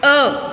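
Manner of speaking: short, distinct अ; isolated अ vowel, clearly voiced; modelled अ sound. Shown as a demonstration. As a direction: say अ for pupils to repeat. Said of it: "सभी मेरे साथ इसका उच्चारण करेंगे".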